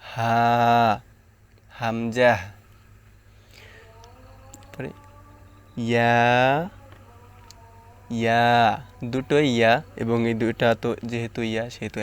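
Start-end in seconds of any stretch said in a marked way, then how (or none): 0.1-2.3 হা and হামজা from the start of the throat; careful letter demonstration of হা and হামজা. Shown as a demonstration. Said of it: "একই জায়গা থেকে উচ্চারণ হয়". 5.9-8.4 held ইয়া with mid-tongue to the palate; careful demonstration of ইয়া from mid-tongue; mid-tongue letter. Shown as a demonstration. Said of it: "জিহ্বার মধ্যখান".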